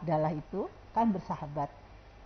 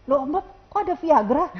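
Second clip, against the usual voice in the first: higher, louder teasing gasp; mock surprise; 7 semitones higher, 9 dB louder than usual. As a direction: fake a shocked discovery to tease him. Prank on Om Bob. (pura-pura kaget sambil menggoda, lebih keras)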